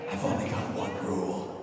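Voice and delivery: a lightly voiced low growl; Batman voice. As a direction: growl the line like a brooding hero. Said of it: "that's the voice".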